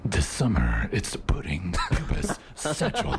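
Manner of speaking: low, slow trailer announcer parody; movie guy voice. Acted. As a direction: intone it like a blockbuster trailer. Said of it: "do my movie guy voice on the radio".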